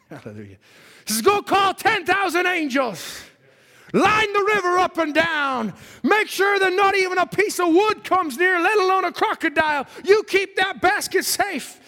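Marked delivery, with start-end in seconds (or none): 1.2-11.8 slow, loud, commanding orders; king sending out his army; commanding God. Acted.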